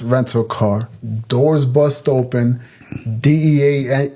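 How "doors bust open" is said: plain reading voice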